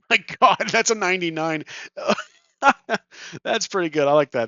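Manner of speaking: loud, high, laughing disbelief; amused, astonished exclamation; real loud outburst. Real, not acted. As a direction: burst out loudly at the lucky roll. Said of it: "blowing your guys' ears out".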